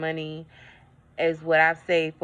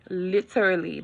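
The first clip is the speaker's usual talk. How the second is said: normal talking voice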